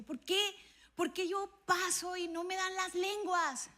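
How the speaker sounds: retold complaint. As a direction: ask God why, plaintively. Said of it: "le decía yo".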